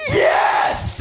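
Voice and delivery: hoarse-edged roar to the crowd; wild, stoned triumph; triumphant shout. Retold or imitated. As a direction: shout a drawn-out "yes". Answers the cheering crowd.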